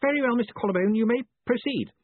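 lightly voiced pompous superior; blustering boss. A narrator's reading. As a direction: grant permission across a great distance. Ridcully grandly addresses the caller.

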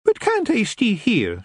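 put-on slow, high, coaxing little-girl voice; wheedling lassie. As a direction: beg daddy sweetly. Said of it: "she wheedled".

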